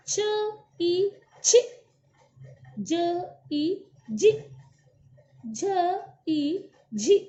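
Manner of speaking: put-on a teacher chanting the blend for children to repeat, slowing right down on the last one; syllable drill. Shown as a demonstration. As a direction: sound out each letter, then the vowel, then the joined syllable. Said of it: "अब हम इसका उच्चारण करते हैं".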